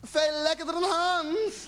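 high, drawn-out mock cry; hammed-up girlfriend's scream; imitated ecstatic scream. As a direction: cry it out high, stretching each phrase. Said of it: "En ze schreeuwt".

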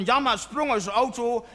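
ordinary talk

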